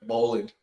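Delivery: "bowling" with the full -ing ending; "bowling" ending in -ing, lightly offered; American pronunciation. Shown as a demonstration. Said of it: "add a little bit of American to it".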